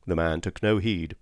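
usual speaking voice

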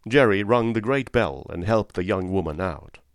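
plain reading voice

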